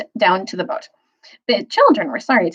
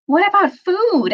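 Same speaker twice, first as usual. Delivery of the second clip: Susan asking; 12 semitones higher, slower than usual. Acted. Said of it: "asked Susan".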